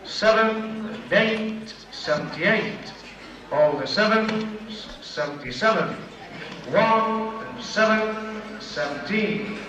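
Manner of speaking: slow drawn-out bingo calling; bingo caller. Real, not acted. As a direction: call each number out slowly.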